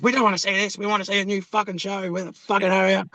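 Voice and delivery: high put-on whiny fan voice; mock the impatient fans, high and nasal-free; demanding fans. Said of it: "people would be like".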